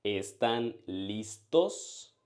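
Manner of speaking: Están listos spoken very slowly and clearly for the learner; slow clear demo. Shown as a demonstration. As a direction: spell it out slowly, syllable by syllable. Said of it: "how to ask, "Are you ready?".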